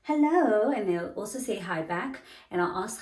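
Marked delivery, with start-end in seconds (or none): 0.0-0.7 hello sung out high and loud; bright high greeting. Retold or imitated. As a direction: greet them with a big cheerful hello. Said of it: "So I'll be like".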